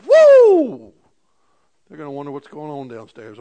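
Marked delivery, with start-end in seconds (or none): 0.1-0.9 loud, high cheering "woo"; excited whoop. Retold or imitated. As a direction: whoop like a fan at a home run. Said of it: "Because you're excited".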